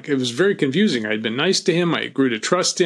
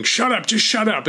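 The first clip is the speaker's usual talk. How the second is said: the jumpy gunman cutting off his hostage; nervous snapping; 9 semitones higher than usual. Retold or imitated. He kept saying.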